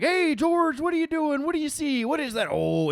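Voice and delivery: sing-song talk to a goose; imitating Bob to his goose. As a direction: Bob cooing at George. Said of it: "Bob's like".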